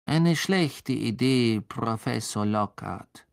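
slow, drawn-out objection; Snape. A narrator's reading. Colleague objecting to the professor's idea, slow and disdainful. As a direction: stretch each word out with cool disdain. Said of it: "sagte Snape".

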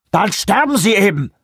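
shrieking official losing his temper; furious shout. Acted. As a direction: scream the insults at him. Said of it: "schrie Vanderbilt".

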